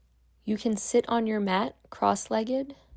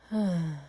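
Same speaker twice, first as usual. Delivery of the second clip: long, falling exhaled sigh; demonstrated letting-go sigh; deep sigh; 3 semitones lower than usual. Shown as a demonstration. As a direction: breathe out with a deep, releasing sigh. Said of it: "exhale with a deep sigh".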